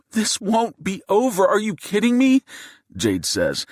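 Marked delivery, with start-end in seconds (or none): 0.1-2.4 high-pitched outraged shout. A narrator's reading. Wife yells at the gunman in disbelief, shouting. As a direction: explode in disbelief, voice way up. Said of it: "and she's shouting".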